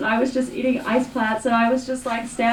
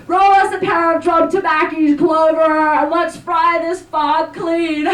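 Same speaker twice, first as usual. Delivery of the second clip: put-on old ranger voice; 5 semitones higher, 5 dB louder than usual. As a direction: ham up the salty old ranger. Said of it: "and he talks like this".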